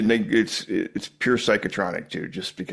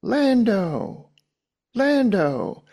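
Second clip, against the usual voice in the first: much higher, drawn-out calls of Lando; eerie calling voice; 12 semitones higher, much slower than usual. Retold or imitated. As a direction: call the name like a haunting echo. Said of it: "he's just going".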